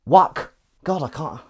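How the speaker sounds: ordinary talk, nothing marked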